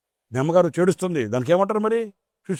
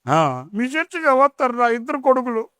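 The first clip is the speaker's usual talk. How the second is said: put-on old woman voice; 10 semitones higher than usual. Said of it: "ఈ ముసలిది".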